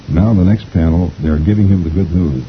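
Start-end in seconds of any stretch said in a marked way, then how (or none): none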